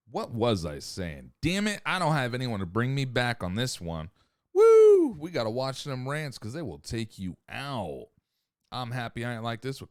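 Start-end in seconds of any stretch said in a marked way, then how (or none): 4.5-5.2 loud whoop. Real, not acted. Whoops after a rant.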